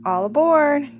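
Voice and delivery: high, loud call down the platform; conductor's call. A narrator's reading. Conductor calling passengers onto the train, loud high call. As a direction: sing it out to the whole station. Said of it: "calls the conductor".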